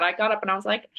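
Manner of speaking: ordinary talk, nothing marked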